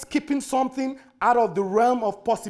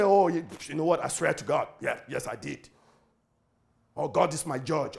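lowered voice, imitating a needless vow; mock oath-swearing; 6 semitones lower than usual. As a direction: act out someone padding a yes with oaths. Acts out the answer not to give.

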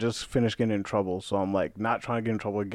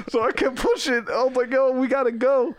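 amused, laughing; 13 semitones higher than usual. Real, not acted. Cracking up over stuck wheelchair.

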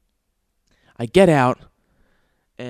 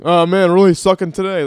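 golfer joke voice; 3 semitones higher, 7 dB louder than usual. Retold or imitated. Dumb jokes between golf groups.

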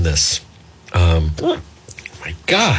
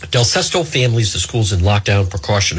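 ordinary talk

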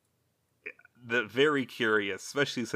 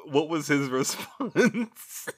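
laughing; 6 semitones higher, 5 dB louder, slower than usual. Real, not acted. Laughing at the absurd scene.

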